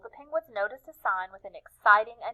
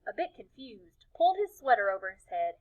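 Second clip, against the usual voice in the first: ordinary delivery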